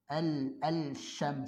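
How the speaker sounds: Al-, al-syam very slow, lam sounded; wrong way again. Shown as a demonstration. Teacher repeats the mistake for students, very slow.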